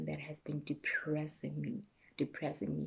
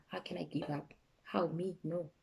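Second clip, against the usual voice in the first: normal talking voice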